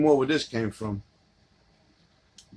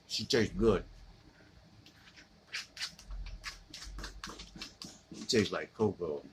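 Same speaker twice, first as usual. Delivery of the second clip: seductive murmur; 7 semitones lower, 12 dB quieter than usual. Pretend. Sweet-talking the cereal bowl.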